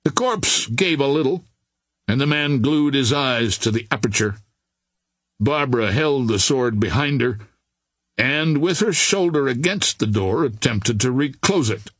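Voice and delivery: ordinary delivery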